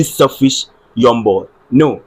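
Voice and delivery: ordinary talk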